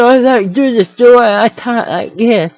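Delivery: high, loud, slow dopey voice; dragged-out dim-witted mimic; mocking dumb voice. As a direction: ham up a dim Jersey Shore fan. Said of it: "if you see anyone doing that".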